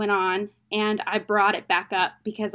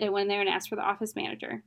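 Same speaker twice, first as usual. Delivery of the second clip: ordinary talk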